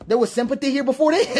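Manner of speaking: incredulous question through a laugh; laughing disbelief. Real, not acted. Reacting to a comment, asks through laughter.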